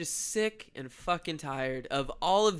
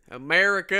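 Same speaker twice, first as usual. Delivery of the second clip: one loud proclaimed word, mock Trump; Trump impression; 6 dB louder than usual. A co-host showing his Trump accent, louder. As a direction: announce it like a rally cry. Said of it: "your Donald Trump accent".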